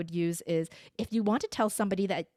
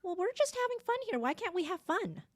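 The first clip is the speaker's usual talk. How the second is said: put-on high, soft little-kid voice; little girl protesting; 10 semitones higher, 5 dB quieter than usual. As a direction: plead back like a small child. Speaker as little girl answering dad.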